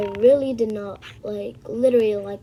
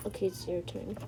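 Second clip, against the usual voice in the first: soft whispered cue; 4 semitones lower, 12 dB quieter than usual. Acted. Whispering to the other kid, it's your turn. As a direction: hush it to the partner.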